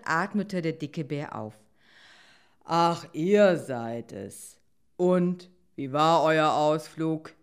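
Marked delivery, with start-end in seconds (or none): 2.6-7.3 slow, loud big-bear voice; relieved bear. Acted. Bear greeting the returning pair, slow and relieved. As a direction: greet them heavily, drawing the words out.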